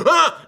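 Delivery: sudden high, loud "ah"; mock scare yell. Reviewer interrupts himself to mimic a jump scare, sudden mock yell.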